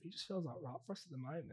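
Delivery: softly spoken put-on Aussie accent; Australian accent. Caller explaining why fostering feels right, Australian accent. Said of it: "I'm actually from Australia".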